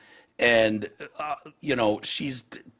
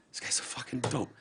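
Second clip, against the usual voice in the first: hushed, voiceless aside; whisper; 11 dB quieter than usual. Retold or imitated. Reacting to the president's speech, whispered. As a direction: breathe it out like a secret verdict.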